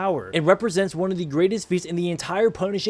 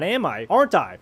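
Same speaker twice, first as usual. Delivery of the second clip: normal talking voice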